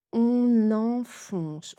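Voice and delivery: "On enfonce" drawn out slowly, every nasal clear; slow careful nasals. Shown as a demonstration. Teacher drawing out the answer for learners, slowly. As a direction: stretch "On enfonce" syllable by syllable. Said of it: "La réponse".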